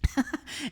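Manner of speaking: short amused laugh. Real, not acted. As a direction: chuckle at your own story. Laughs at own family story.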